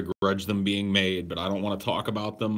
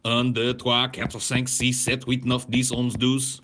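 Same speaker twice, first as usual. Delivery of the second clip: counting in French; 4 semitones higher, 5 dB louder than usual. Shown as a demonstration. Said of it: "impugn my French".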